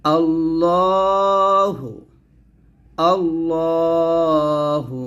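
আল্লাহু recited slowly with a full, thick L; heavy drawn-out recitation. Shown as a demonstration. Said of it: "মোটা করে পড়তে হবে". (আল্লাহু-র "ল" মোটা করে টেনে পড়া)

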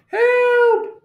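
very high, comic squeal; cry for help. A narrator's reading. Toad blinded by bright sun, high cry for help.

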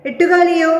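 high, loud startled question; surprised call. Acted. Teacher reads character's cry. (ഉയർന്ന ശബ്ദത്തിൽ അമ്പരന്ന ചോദ്യം)

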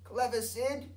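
high, praising grandma voice; proud grandma. A narrator's reading. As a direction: praise the dog. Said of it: "said Grandma".

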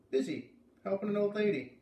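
put-on kid's voice, high and lively; boy's voice. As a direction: answer like a busy young boy. Narrator boy answers Beat.

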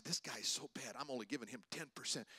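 quietly complaining to his wife about the waiter; murmured under the breath at the table; hushed aside. Retold or imitated. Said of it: "My wife, I say".

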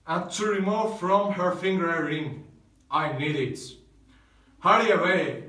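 stern command. Acted. Romeo threatens Balthazar at tomb.

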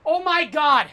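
real gamer meltdown; angry rant. Real, not acted. Ranting about distracting voice lines.

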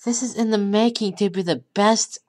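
usual speaking voice

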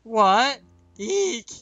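very high, loud startled what then eek; frightened squeak. Acted. Trembling waitress squeaking at the scowl, frightened. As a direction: jump and squeak.

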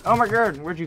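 high, loud shocked yelp; startled shout. Real, not acted. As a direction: jump at the enemy appearing. Enemy appears suddenly.

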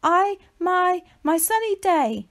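nervous tortoise stammering; stuttering. A narrator's reading. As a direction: trip over the first words. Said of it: "stuttered Shelley".

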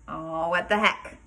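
plain reading voice

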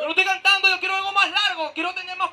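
más alto y agudo, reclamando. Acted. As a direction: protesta fingida en voz alta. Discusión actuada en concierto. (reclamo en broma, más fuerte)

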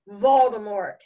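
loud, forceful single word; bellowed. A narrator's reading. Boy shocking the weeping gamekeeper with the name, bellowed. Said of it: "Harry bellowed".

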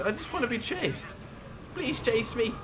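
high, pitiful mock whine; hammed-up plaintive plea; mock pleading. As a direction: beg playfully, voice climbing. Jokingly pleads to be chased.

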